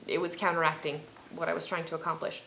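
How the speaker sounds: ordinary talk, nothing marked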